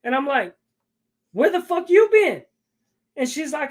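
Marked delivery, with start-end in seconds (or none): 1.3-2.4 higher and louder, retold angry yell; angry demand. Coming in with the gun, he confronts his wife, angry. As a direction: bark the question at her. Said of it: "I'm pissed off".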